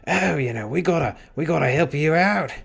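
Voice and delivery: mock a friendly Aussie offering help; Australian accent. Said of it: "There's a lot of".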